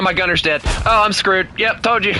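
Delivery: ordinary delivery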